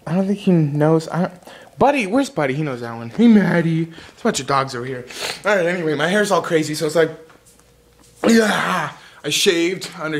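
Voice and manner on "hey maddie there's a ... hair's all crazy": ordinary talk, nothing marked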